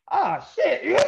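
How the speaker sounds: high laughing yelp of dismay; laughing shout. Real, not acted. Telling the host how his buddy signed up, a high laughing yelp. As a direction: burst out laughing in mock dismay. Realizes friend also signed up.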